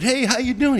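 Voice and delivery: mimicking the strong simple roadie; simple guy's greeting. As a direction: do Dean's friendly simple greeting. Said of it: "came up and said".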